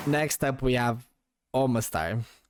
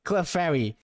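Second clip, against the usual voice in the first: normal talking voice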